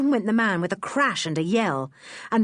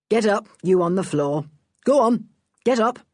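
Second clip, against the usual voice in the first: louder firm order; a hint of stern inspector; 2 semitones higher, 4 dB louder than usual. The inspector ordering Lou off the floor, mildly stern. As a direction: order the crook up.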